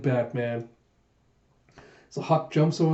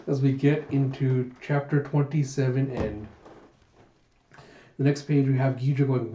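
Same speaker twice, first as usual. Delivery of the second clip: plain reading voice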